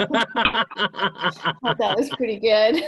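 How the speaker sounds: laughing through the words; can't stop laughing at the joke. Real, not acted. Mother laughing at her daughter's joke about freezing.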